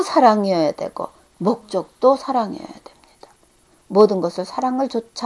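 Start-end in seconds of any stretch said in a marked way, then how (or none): 1.4-3.3 whisper. Acted. Sudden hush in sermon.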